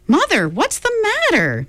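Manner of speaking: put-on high alarmed girl; alarmed cry. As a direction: cry out to mother in fright. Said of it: "Meg cried".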